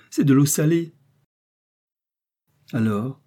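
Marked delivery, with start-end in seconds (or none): 0.1-0.9 much higher, louder furious cry; angry outcry. A narrator's reading. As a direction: howl it in bitter anger. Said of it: "dit-il avec colère".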